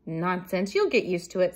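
loud, brisk storybook brush-off; unbothered mouse in her ordinary pitch; dismissive. A narrator's reading. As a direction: wave away the fear firmly. Mouse brushes off friend's fear.

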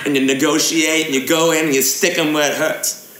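brisk haggler's fake English accent; put-on English accent. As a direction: wheel and deal in a mock English accent. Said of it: "gaming in an English accent".

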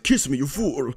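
lightly hammed-up melodramatic quote; cartoon lover. Cartoon lover demanding a kiss, lightly hammed up. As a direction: play a mock cartoon romance line. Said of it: "That's from, like, a cartoon".

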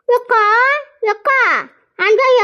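high-pitched call to a neighbour. Acted. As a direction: call her out of the house, drawing out "akka". Calling neighbour at her house.

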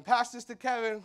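high, loud family call; mock call. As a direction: call it across the airport. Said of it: "they're like".

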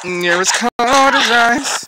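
slow, high, loud yell at the screen; furious shout. Real, not acted. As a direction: stretch it out and yell at the fighter. Yelling at the game opponent.

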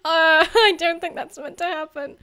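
loud, rising "uh" through laughter; high, laughing mock alarm; retold confused alarm. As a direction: re-enact your baffled reaction, laughing. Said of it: "I was like".

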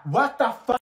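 loud, high shocked exclamation; shocked outcry. Acted. Friend shouting in shock at the outburst. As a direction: react in sudden disbelief.

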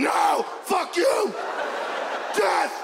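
high, shouted tantrum; crazy defiant kid. Acted. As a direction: yell back like a bloodthirsty child. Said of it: "He's crazy".

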